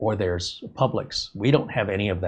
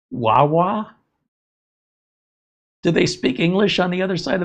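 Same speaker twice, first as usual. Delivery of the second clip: incredulous, pitched up and louder; mock disbelief; 9 semitones higher, 4 dB louder than usual. Real, not acted. Ridiculing an East Coast gas station name, incredulous. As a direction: question the odd name in disbelief. Mocking an unfamiliar store name.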